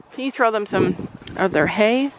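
ordinary talk, nothing marked

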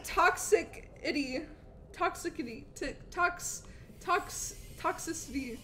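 usual speaking voice

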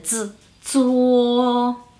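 "Z" buzzed alone, then "zuo" drawn out level; vibrating Z. Shown as a demonstration. Teacher pointing out the vibrating sound, "Z" then held "zuo". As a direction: isolate the dz of "Z", then glide into long "zuo". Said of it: "un poquito vibrante".

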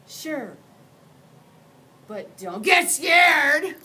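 booming, slow monster call; loud monster warning. Acted. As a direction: warn the kid with a big voice. Slimy tail monster speaks.

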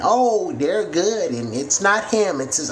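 high, slow mocking parent excuse; mock sugarcoating. Vlogger mocks parents excusing their kids, sugarcoated mock. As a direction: drawl it out like a parent making excuses. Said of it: "try to sugarcoat it".

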